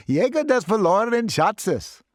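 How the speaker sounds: high, loud shout from the back. Acted. Student in the back row shouts the answer. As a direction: blurt the answer across the room. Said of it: "A big guy near the back shouted out".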